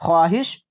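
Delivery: ख्वाहिश as "khwaahish"; as it is written. Shown as a demonstration. Teacher points to the written form, ख्वाहिश with w. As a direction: keep the w in ख्वाहिश. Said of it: "इस तरह से लिखी जा रही है".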